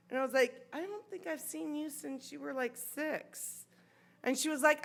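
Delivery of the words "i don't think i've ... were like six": softly spoken, stunned reply; awkward small talk. Retold or imitated. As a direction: drop the volume, thrown off balance.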